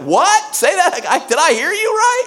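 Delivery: high, disbelieving gasp of a question; can't believe your ears; incredulous. Pretend. A believer imagining hearing the promised son, stunned. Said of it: "just incredulity".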